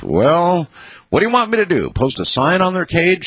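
high-pitched sarcastic retort. A narrator's reading. Wife answering husband's accusation about the lizards, sarcastic retort. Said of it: "she said this rather sarcastically".

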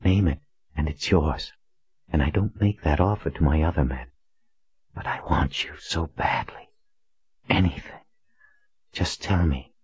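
hushed, breathy seductive voice; sultry whisper. A narrator's reading. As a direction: breathe it close to his ear. Courtesan Rose speaks.

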